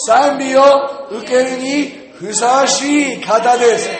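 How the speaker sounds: very loud, high, drawn-out proclamation; slow, shouted scripture declaration. Shown as a demonstration. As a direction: lead the crowd at full volume, phrase by phrase. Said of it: "大声".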